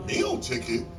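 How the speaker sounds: high disbelieving question; confused hungry man repeating the guard, high surprise; surprised echo. Retold or imitated. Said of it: "He says".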